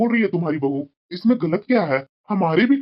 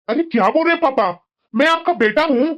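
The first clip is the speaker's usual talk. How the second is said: higher-pitched, insistent reassurance; earnest protest; 7 semitones higher than usual. Acted. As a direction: protest to your father that you care. Son protests father's self-blame.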